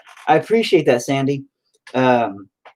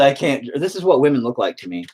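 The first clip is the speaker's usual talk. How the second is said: ordinary talk, nothing marked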